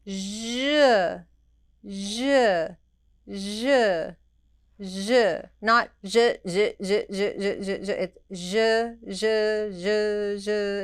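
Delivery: steady buzzing zh at an even tempo; even zh stroke sounds. Shown as a demonstration. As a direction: voice the smooth in-and-out stroke. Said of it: "same tempo".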